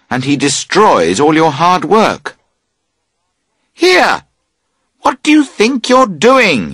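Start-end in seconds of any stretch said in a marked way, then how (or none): 3.7-6.7 high, loud indignant call. A narrator's reading. Camper calling to his friend pulling the tent, loud indignant call. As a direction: call out sharply across the tent. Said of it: "you call out".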